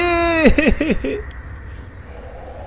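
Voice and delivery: high-pitched panicky giggle; jittery laughter; nervous laugh. Real, not acted. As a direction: laugh out the fright. Nervous laugh under attack.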